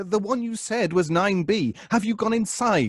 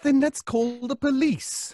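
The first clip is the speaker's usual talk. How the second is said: pitched-up exasperation; exasperated; 8 semitones higher than usual. Acted. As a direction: push to call the police. Frustrated with the superintendent.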